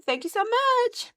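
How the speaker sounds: high, light grateful thanks; sweet thank you. Retold or imitated. Retold grateful reply.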